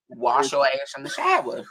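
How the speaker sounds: Worcestershire turned into "wash your ass in the shower"; deliberate mispronunciation. Acted. Host defending her mangled sauce name, mispronounced on purpose. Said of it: "saying that right".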